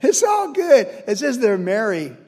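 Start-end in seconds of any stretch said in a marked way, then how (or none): 0.0-0.9 high, loud cheer; carefree shout. Retold or imitated. Brothers celebrating their escape, with a high loud cheer. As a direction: shout it out in relief.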